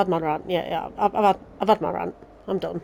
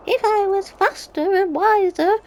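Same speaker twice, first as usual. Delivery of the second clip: higher, slow, whiny mock quote; mocking Minfilia; 12 semitones higher, slower than usual. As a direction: parody her self-pity in a higher, dragged-out voice. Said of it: "what's Minfilia on about".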